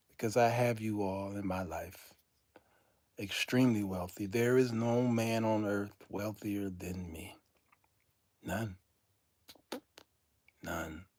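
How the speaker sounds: low, hushed gratitude; falling to a near murmur; soft, sincere. Real, not acted. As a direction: confide it quietly, from the heart. Sincere thanks to the viewers.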